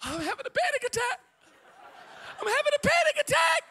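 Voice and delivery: high, loud mock panic, squealing it; hammed-up hysterical woman; mimicking panicked lady. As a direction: play the frantic lady near tears. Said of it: "One lady's like".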